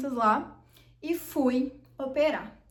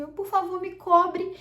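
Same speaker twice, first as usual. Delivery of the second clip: higher, louder begging for help; retold plea; 7 semitones higher, 7 dB louder, slower than usual. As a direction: act out an urgent request to the nurses. Retold plea to nurses for cover.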